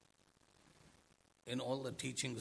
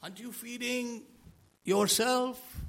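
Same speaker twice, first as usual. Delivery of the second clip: mock scolding; 8 semitones higher, 7 dB louder than usual. Imagined onlooker scolding.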